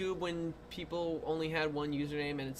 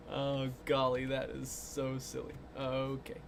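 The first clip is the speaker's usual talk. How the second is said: usual speaking voice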